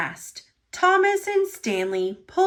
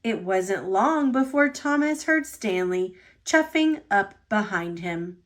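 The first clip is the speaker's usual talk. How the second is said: ordinary delivery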